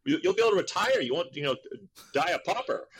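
father lecturing son on the union, lightly mocked and high; lecturing dad. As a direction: explain it like an exasperated father. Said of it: "And he goes".

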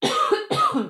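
harsh burst of coughs; coughing fit. Real, not acted. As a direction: cough hard a few times. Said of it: "Sorry, I'm coughing".

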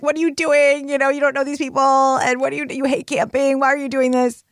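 exasperated inner critic; scolding inner voice. Retold or imitated. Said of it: "I'm driving there and I'm like".

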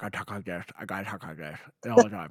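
put-on lips pulled over gums, old man talk; old person voice. Shown as a demonstration. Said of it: "I talk like an old person".

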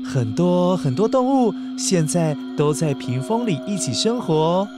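ordinary delivery, nothing marked

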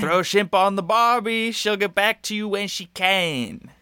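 high, drawn-out fake Australian; badly faked Australian, slowing at the end; put-on Australian accent. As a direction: repeat the prompt in a hammed-up Aussie accent. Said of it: "do it, like, in your native accent".

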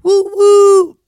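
high, loud whooping hype; excited cheer. Real, not acted. Host hypes Atlanta trips, cheering loud and high.

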